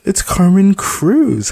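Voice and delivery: high bright cheerful name; cheery. Acted. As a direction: sing her name brightly. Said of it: "I cheered".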